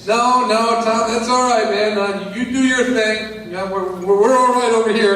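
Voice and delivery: lightly voiced condescending neighbor; dismissive neighbor. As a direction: back away politely, amused.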